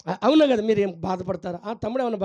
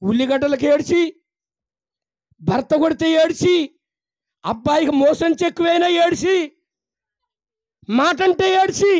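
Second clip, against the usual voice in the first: rising preaching shout; 8 semitones higher, 5 dB louder than usual. Real, not acted. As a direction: build to a full preaching shout, beat by beat. Preacher lists reasons people weep.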